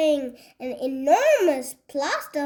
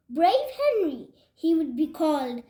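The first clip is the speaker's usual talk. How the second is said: ordinary delivery, nothing marked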